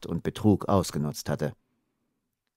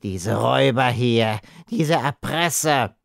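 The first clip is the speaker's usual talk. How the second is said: slow, strained groan, higher in pitch; 4 semitones higher, slower than usual. A narrator's reading. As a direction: groan out the accusation laboriously. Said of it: "ächzte er".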